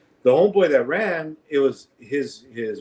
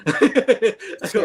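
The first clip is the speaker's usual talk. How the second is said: laughing; 8 semitones higher than usual. Real, not acted. Laughing at own story.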